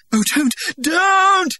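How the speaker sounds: slow, high, loud trembling plea; tremulous protest. Acted. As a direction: protest while being shaken. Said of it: "grotesquely tremulous by his shaking".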